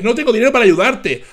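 mock his words back at him; mocking quote. Host parroting the guest's father, mocking and loud. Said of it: "Qué embustero eres".

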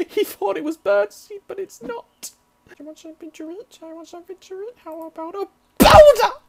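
high squeaky teasing voice; squeaky mock voice. Gamer teasing a bird in a high squeaky voice.

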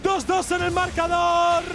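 high-pitched rapid-fire fight call; excited esports play-by-play. Acted. Caster calls a chaotic team fight, excited high play-by-play. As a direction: build the fight to fever pitch. Live match casting.

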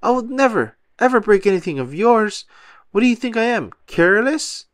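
high, insistent hurt protest; indignant rant. A narrator's reading. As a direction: keep the upset tirade going. Patrick ranting, breaking things.

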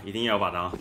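normal talking voice

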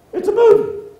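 high, loud mock protest; mock exclamation. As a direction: burst out with a mock-incredulous objection. Acts out an objection to crying.

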